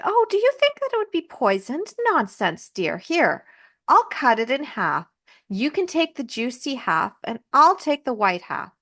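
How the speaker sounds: put-on high, sugary old woman coaxing; peddler woman. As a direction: coax and reassure in a high put-on old-lady voice. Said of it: "the peddler woman is like".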